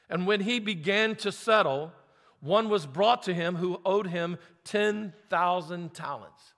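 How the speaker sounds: formal scripture read. Acted. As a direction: read the passage out to the congregation. Pastor reads parable aloud.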